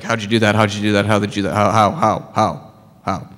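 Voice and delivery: low, insistent badgering on repeat; mock pest who won't let up; pestering questions. Shown as a demonstration. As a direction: hammer the question over and over. Acting out pestering people with questions.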